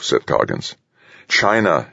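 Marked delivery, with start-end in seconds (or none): 1.3-1.9 higher-pitched, insistent correction. A narrator's reading. Man insisting to colleague that China is behind this, insistent. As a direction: correct her with conviction. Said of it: "he insisted".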